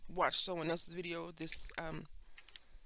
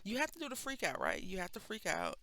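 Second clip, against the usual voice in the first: ordinary talk, nothing marked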